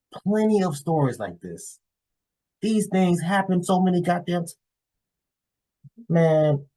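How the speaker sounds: high-pitched exasperated venting. Real, not acted. Vlogger venting to fellas about plenty of such stories, exasperated.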